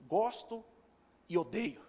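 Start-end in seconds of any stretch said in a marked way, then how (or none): none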